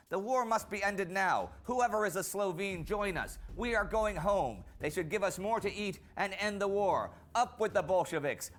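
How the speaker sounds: rally the comrades to march home; rallying speech. Acted. Narrator voices Slovene mutineers to comrades, rallying speech.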